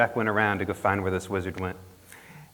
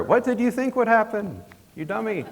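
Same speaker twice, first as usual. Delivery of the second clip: mock exasperated squeak; 12 semitones higher than usual. Vampire's final words to Jack.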